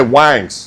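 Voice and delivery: "Wangs." with a drawn nasal a, said as wangs not wings; saying wangs. Shown as a demonstration. Showing his friend his new catchphrase, "Wangs." said as wangs. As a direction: say "Wangs." with a broad a, like wangs. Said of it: "I have to say".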